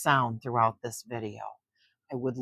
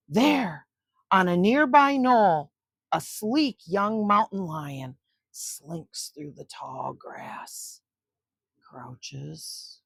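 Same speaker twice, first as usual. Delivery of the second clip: put-on expressive read-aloud narration for kids; story reading; 2 semitones higher, 3 dB louder than usual. A narrator's reading. Grandma reading a nature book to children, storytelling voice. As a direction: read it like grandma at bedtime, with suspense.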